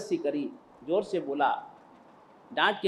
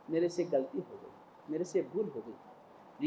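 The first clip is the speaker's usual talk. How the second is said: meek apology; 2 semitones lower, 8 dB quieter than usual. Acted. Disciple apologises to guru.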